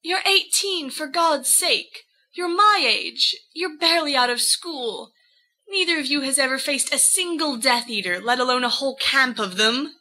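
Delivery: Lily's voice pitched high, incredulous; Lily, indignant and disbelieving. A narrator's reading. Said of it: "says Lily".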